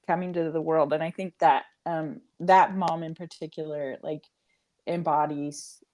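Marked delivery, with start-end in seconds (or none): none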